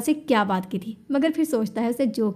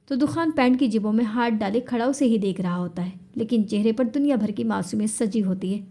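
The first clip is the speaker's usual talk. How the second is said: usual speaking voice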